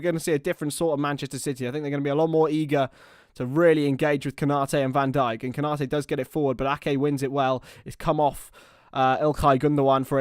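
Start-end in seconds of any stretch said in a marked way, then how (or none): none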